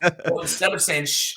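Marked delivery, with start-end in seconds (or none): 1.1-1.3 long unvoiced "shh"; shushing. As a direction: hush them with a drawn-out shh. Golfer imagined shushing fans.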